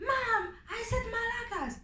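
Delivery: put-on a little girl fed up with mom; exasperated daughter.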